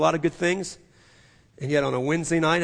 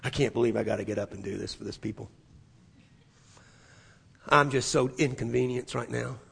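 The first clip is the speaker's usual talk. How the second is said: grumbling; 6 semitones lower, 4 dB quieter than usual. Retold or imitated. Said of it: "huffing and puffing".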